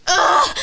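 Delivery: loud, high huff of disgust; exasperated ugh. Retold or imitated. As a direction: imitate a friend's dramatic fed-up groan. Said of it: "And she's like".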